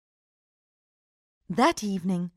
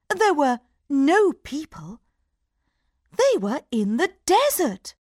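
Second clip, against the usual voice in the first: usual speaking voice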